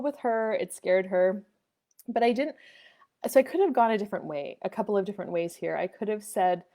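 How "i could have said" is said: normal talking voice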